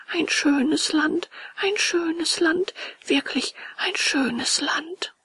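high, very slow, drawn-out rapture; dreamy wonder. A narrator's reading. Puppet finally tempted by lazy land, slow high wonder. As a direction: savour it slowly in a high boy's voice.

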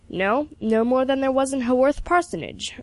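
mock-gruff retort from an old mentor; growling. A narrator's reading. Mentor answering his pupil's excuse, a mild growl. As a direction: growl it sarcastically, only lightly. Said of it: "growled Mister Carpenter".

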